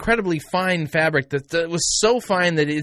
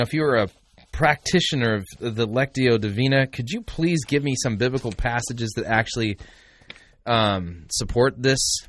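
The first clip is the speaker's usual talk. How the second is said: ordinary delivery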